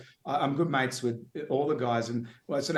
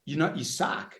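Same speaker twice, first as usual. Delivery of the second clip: mock pep talk; 6 semitones higher than usual. Reassuring mate Brian backstage.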